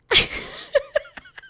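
shrieking giggle up high; high squeal of real laughter. Real, not acted. Laughing at the jugs line.

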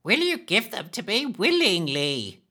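high, sly hag hissing; greedy hiss. Acted. Hag demands the sweets from Rusty, hissing. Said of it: "hissed the woman".

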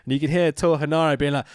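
usual speaking voice

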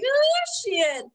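high-pitched amazed reaction; awed exclamation. Retold or imitated. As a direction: squeal it in amazement. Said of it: "I'm like".

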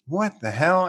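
mild retold shock; startled disbelief. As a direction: caught off guard by squealing tyres. Hears wheels squealing.

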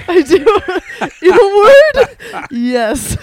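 high, loud words through laughter; laughing. Real, not acted. Laughing answer.

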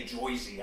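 JOYZY with an oy vowel; "Joyzy", first syllable like joy; oy pronunciation of Jersey. Shown as a demonstration. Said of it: "people say we, we say Joyzy".

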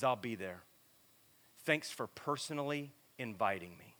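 low, soft retold reply; quietly voiced acceptance in a retelling; subdued acceptance. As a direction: drop it low and quiet, understated. Retelling an angry man's unexpected acceptance.

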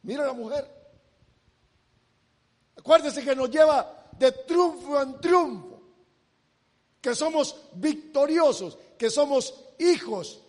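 ordinary talk, nothing marked

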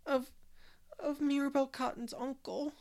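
put-on a scared child getting the words out between sobs; sobbing little girl. Dora answering Anne, sobbing and stammering. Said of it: "sobbed Dora".

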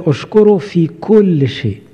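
slow emphatic quote. Acted.

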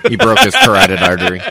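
high, loud words through laughter; laughing. Real, not acted. Host joking about the choking, laughing. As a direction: giggle the line out.

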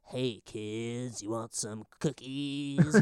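low, soft put-on character voice; Chris Kattan. Shown as a demonstration. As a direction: creep into it quietly, drawing out the offer. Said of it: "a really good Chris Kattan impression".

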